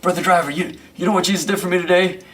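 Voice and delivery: hammed-up fervent choir man; fervent church brother. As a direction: lean over and press him. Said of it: "and he says".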